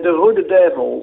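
"Rode Devils" said slowly, the Belgian way; native nickname pronunciation. Shown as a demonstration. Belgian guest showing the host the team nickname, native pronunciation. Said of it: "How do you pronounce".

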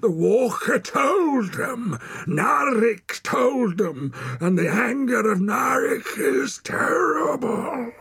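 slow, high, loud roar; roaring warning. A narrator's reading. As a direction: roar it like a raving prophet of doom. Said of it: "roared the man".

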